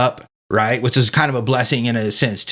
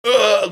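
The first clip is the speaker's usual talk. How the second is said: disgusted groan; 11 semitones higher, 3 dB louder than usual. Retold or imitated. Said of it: "And I'm like".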